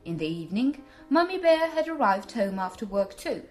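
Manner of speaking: ordinary delivery, nothing marked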